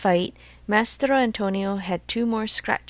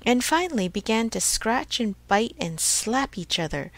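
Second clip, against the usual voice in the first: usual speaking voice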